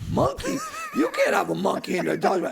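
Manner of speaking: loud, high-pitched disbelief; shocked clerk. Retold or imitated. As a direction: balk in alarm at the request. Acts the shocked hotel clerk.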